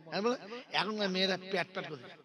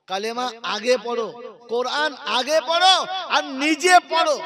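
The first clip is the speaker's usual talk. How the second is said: preacher's shout; 6 semitones higher, 10 dB louder than usual. Real, not acted. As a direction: hammer the order home, rising to a shout. Preacher exhorting the crowd.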